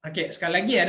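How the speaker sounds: normal talking voice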